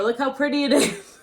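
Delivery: ordinary talk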